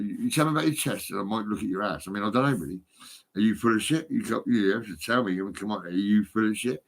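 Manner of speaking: normal talking voice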